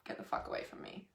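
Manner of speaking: unvoiced whispered brush-off; hissed whisper. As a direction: breathe it out like a shut door. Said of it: "Energetically, it's like".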